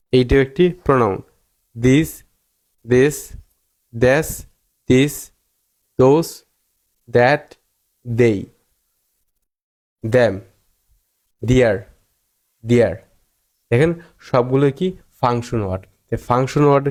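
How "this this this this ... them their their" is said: "this, those, that, they" each begun with দ. Shown as a demonstration. Teacher listing function words, th said as d in "this, those, that". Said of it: "উচ্চারণ করতে হবে দয়ের মতো".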